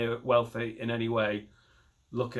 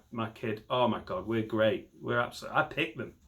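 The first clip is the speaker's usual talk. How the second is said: ordinary talk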